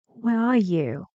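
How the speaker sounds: "Where are you" with "you" going down; falling intonation. Shown as a demonstration. Teacher confirms it goes down, falling "you". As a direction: peak early, then sink on "you". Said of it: "Goes down".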